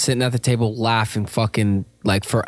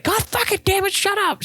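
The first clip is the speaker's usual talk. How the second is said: much higher, mock-angry teacher squeal; mock furious teacher. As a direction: ham up an exasperated teacher. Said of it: "She didn't say that".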